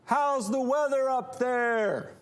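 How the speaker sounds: high, slow holler to someone towering above; little creature's sustained high-pitched call; Trid calling up. Acted. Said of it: "And then the Trid would go".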